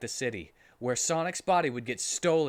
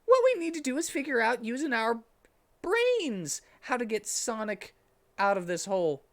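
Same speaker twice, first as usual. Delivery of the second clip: put-on higher boyish voice, a bit bossy; Tails' voice; 9 semitones higher than usual. A narrator's reading. Tails explaining the plan to the group, higher and bossy. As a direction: lay out the plan like a know-it-all. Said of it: "in a mad, serious voice".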